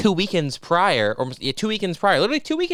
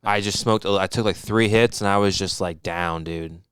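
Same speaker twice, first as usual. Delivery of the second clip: low, heavy mock confession; sample stoner tone; 7 semitones lower than usual. Shown as a demonstration. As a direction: drop the pitch and sigh through it. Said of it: "is it one of these where it's like".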